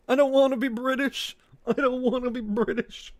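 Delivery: high, mock-plaintive British accent. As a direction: protest high up in a put-on British voice. Complains in the put-on British voice.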